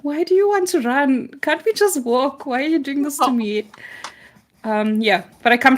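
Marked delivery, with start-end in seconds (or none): 0.0-3.7 high, pleading, mock-whiny protest at her running dream self; begging her dream self not to run, in a higher whine; whiny complaint to self. Waking up sad and pleading with her dreaming self in a mock whine. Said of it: "I'm like".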